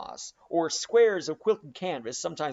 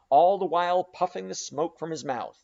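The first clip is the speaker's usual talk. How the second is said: ordinary delivery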